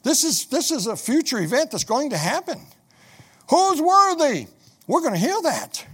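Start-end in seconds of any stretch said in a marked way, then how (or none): 3.5-4.5 high, loud heavenly cry; angel crying out. Acted. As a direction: cry it out over all heaven. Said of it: "somebody cries out".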